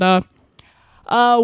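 ordinary talk, nothing marked

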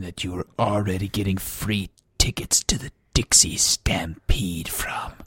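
hushed, slowing down; whisper. Acted. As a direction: whisper the punchline. Whispered salesman aside.

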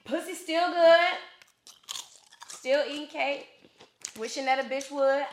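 high, loud singsong catchphrase; задорная кричалка. Acted. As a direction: chant it high, drawing out each line. Recites the show's catchphrase in performed style.

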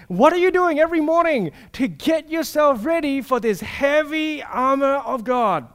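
ordinary talk

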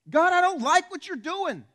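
high, loud protest at God; indignant complaint. Acted. People complaining to God about his ways, indignant shout.

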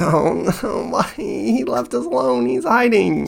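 very high, pleading voice; anxious Kuro. Acted. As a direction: plead to get out of there. Kuro protests staying.

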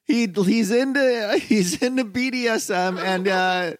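fake Russian. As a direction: put marbles in your mouth. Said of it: "Your fake Russian is fucking nuts".

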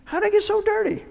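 high-pitched mock bewilderment; mock clueless wallower. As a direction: whine it high, imitating a clueless sinner. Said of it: "you're over there wallowing in the mud, saying".

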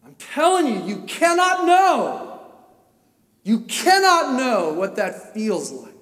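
loud, high, drawn-out insistence; impassioned insistence. Real, not acted. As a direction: shout it slowly, driving it home. Presses the anguish on listeners.

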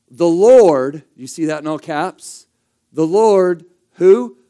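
the Lord rung out loud; stressed name. Shown as a demonstration. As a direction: hit 'The Lord' hard. Preacher stressing the divine name.

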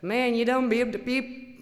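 bleeped cursing, loud and rough; a hint of a rowdy trucker; rough stranger voice, lightly put on. The giant trucker cursing at her crash, loud. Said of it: "he's like".

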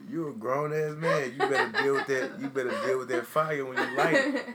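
loud, laughing scolding; laughing lecture. Real, not acted. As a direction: laugh while telling him to man up. Lecturing friend through laughter.

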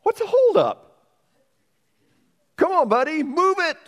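loud, high, impatient heckle from the back; high, loud mock yell of an impatient onlooker; impatient crowd. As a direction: shout it like a fed-up man in the crowd. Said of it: "they began saying".

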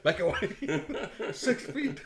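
high, loud laughing quips; laughing joke. Real, not acted. Joking about cars passing, laughing.

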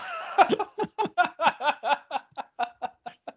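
high, rolling real laugh. Real, not acted. Podcaster cracking up at co-host's blunt summary, laughing.

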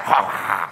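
low, quiet evil chuckle; a deep sinister mock laugh; cartoon devil's low cackle. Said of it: "and the devil, like".

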